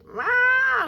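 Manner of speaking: sharp high yelp of a dog in pain; dog's protest growl. Retold or imitated. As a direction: bark out a high pained "Rah!". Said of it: "she was like".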